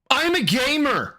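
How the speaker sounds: high put-on gamer voice; lightly voiced gamer brag; mocking voice. Mocking Phil's line.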